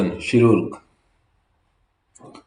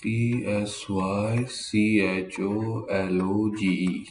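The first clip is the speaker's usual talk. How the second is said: slow spelling; much slower than usual. Shown as a demonstration. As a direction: spell each letter with a pause. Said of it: "जो spelling बोल रहा हूँ". (धीरे-धीरे अक्षर बोलना)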